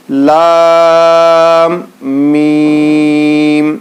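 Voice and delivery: each letter name stretched long and slow, like a chant; drawn-out letter recitation. Shown as a demonstration. Teacher slowly chanting letter names to students. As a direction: sing out each letter slowly for the class to repeat.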